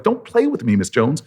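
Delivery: high-pitched, impatient begging; urgent pleading. Retold or imitated. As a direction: plead eagerly for the answer. Begging her for the answer.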